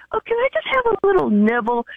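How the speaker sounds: a touch of high, mock pleading; wheedling request. Retelling asking husband for steak.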